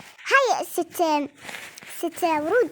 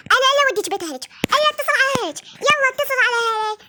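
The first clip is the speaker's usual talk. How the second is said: much higher, louder threat to call family; angry threat; 8 semitones higher, 4 dB louder, faster than usual. Acted. Doll parent threatens to call the in-laws, shouting. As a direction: yell it as a furious doll parent.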